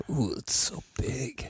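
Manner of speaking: whisper. Pretend. Whispering close to the mic.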